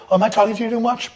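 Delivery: high mock-innocent imitation; mimicking him. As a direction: squeak it like the clueless chatty guy. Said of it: "he'll go".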